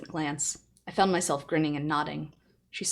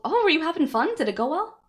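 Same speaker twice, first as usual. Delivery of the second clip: excited; 11 semitones higher, 6 dB louder than usual. Real, not acted. Excited chat reply to viewer.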